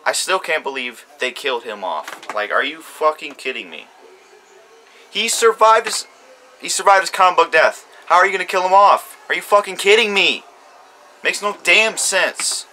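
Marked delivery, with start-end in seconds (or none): 5.1-12.7 louder and higher, indignant outburst; exasperated rant. Real, not acted. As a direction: vent the outrage at the show. Ranting about a character's death.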